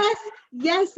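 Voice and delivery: delighted showman. Retold or imitated. Voicing the showman dog, high and eager. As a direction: cheer it like a proud showman.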